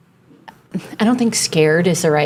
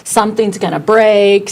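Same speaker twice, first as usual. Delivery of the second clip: louder, raised voice of comic dread; mock paranoid worry; 4 semitones higher, 4 dB louder than usual. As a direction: ham up your own fearfulness. Acting out own anxious worries.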